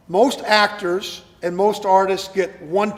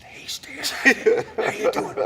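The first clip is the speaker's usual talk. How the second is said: airy whispered greeting, half laughing; voiceless whisper; 4 semitones higher, much slower than usual. Retold or imitated. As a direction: whisper it with no voice, like you've lost it. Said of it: "Why is he whispering to me?".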